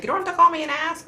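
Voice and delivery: husband brushing off her phone call, high and quick; mock husband voice. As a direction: toss it off like an exasperated husband. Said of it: "He's like".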